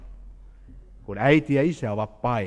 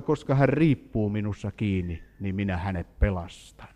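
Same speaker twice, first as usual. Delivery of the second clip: softer, lower reassuring voice; quietly, like a promise; quoting the Lord's promise in a hushed, tender voice; 5 semitones lower, 5 dB quieter than usual. Retold or imitated. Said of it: "Herra sanoi".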